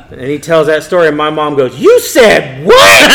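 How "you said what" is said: high, loud, slow disbelieving shout; outraged. Retold or imitated. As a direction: stretch each word in shocked fury. Mom hears the story, slaps him.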